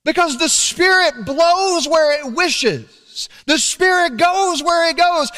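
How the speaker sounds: high, loud, slow proclamation; full-force preaching. Acted. Pastor proclaiming the Spirit's seal to the church, at full force. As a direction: preach it out over the congregation.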